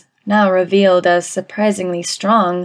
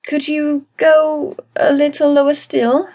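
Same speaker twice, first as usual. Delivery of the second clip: higher, mildly nervous request; voice cracking a little; 7 semitones higher than usual. A narrator's reading. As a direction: ask timidly, raising the pitch. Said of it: "in a voice that cracked a little".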